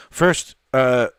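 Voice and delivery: breathless, catching air; winded, gasping between words; out of breath. Real, not acted. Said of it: "I'm just out of breath here".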